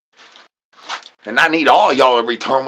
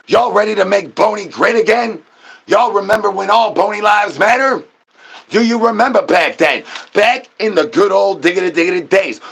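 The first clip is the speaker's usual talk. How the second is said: higher, rally the crowd; hype call to chat; 6 semitones higher than usual. Acted. Streamer rallying his chat, hyped-up call. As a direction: pump up the viewers like a rally host.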